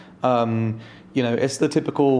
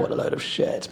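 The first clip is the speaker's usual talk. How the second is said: hushed, mock-weary mutter under the breath; unenthusiastic English reaction; 6 dB quieter than usual. Ninety-nine English people reacting to one American, muttered disdain. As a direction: imitate reserved disdain, almost voiceless. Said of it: "English people are gonna be like".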